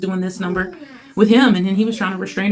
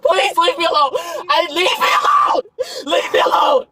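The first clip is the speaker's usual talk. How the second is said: crying screams; 13 semitones higher, 3 dB louder, slower than usual. Real, not acted. Screaming woman in police video clip.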